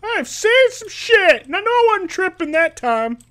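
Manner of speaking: high, loud, agitated guard; Carl. Acted. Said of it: "Carl's like".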